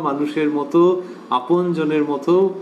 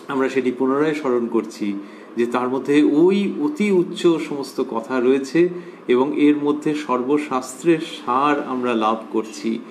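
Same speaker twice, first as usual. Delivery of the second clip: normal talking voice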